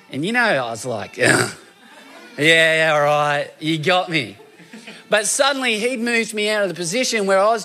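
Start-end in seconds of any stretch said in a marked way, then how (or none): 1.2-4.4 a louder, laughing "yeah, you got me"; sheepish concession. Retold or imitated. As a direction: give in like someone who has been found out. Conceding to the Lord.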